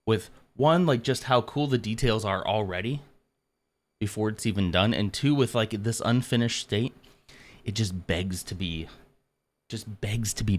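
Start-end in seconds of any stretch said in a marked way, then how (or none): none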